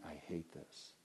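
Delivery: low, hushed and pained; soft, heavy-hearted words; quiet grieving Jesus. Acted. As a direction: speak as Jesus in quiet grief. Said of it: "he's saying".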